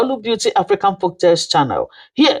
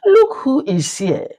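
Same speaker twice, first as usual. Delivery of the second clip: much higher, mocking sneer; 10 semitones higher than usual. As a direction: taunt the girl from above. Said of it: "one of them sneered".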